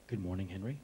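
serious hush. Retold or imitated. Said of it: "'Cause you're being really serious".

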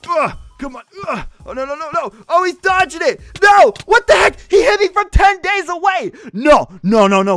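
high, loud raging shouts; rage. Real, not acted. Gamer raging at a suspected hacker, loud shouting. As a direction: yell it out like a gamer losing it. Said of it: "seeing all my Skywars rages".

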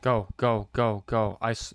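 ordinary talk

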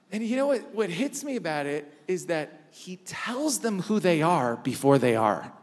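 ordinary talk

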